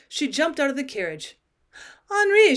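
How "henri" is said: high, loud call of a name; crying out. A narrator's reading. Wife hailing her cousin outside his house, crying out. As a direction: call out urgently to catch him. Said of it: "she cried".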